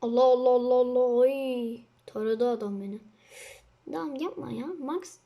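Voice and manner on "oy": ordinary talk, nothing marked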